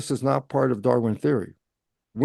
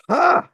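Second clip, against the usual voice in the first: triumphant ha; 5 dB louder than usual. Retold or imitated. Mock reaction in self-dialogue.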